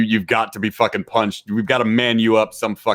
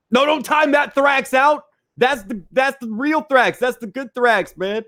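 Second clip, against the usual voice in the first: high-pitched plea; 13 semitones higher, slower than usual. Real, not acted. Begging mod to keep Thrax.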